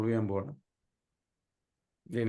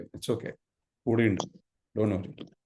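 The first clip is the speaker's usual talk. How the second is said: soothing whisper; 4 semitones lower, much slower than usual. Shown as a demonstration. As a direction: hush and reassure the hurt inner child gently. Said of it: "അതിനെ സമാധാനിപ്പിക്കാതെ".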